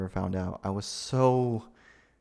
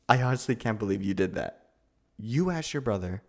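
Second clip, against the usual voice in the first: usual speaking voice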